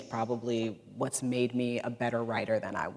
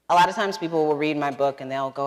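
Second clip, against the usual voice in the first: ordinary delivery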